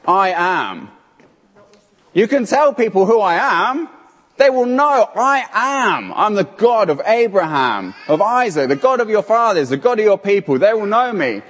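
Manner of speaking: usual speaking voice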